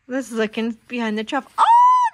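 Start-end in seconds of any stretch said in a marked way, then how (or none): 1.6-2.1 high, loud hammed-up oh; big mock gasp for the toddler; surprised oh. Mother showing the worm, hammed-up surprise. Flap reveals worm in apple.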